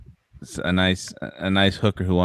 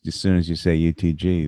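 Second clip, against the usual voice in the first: ordinary talk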